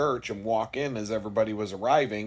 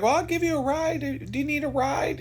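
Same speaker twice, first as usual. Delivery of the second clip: much higher, sing-song offer of a ride; pushy friendly churchgoer. Retold or imitated. Church people offering the walker a ride home, sugary high voice. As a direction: mimic the overly helpful churchgoer. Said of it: "People would be like".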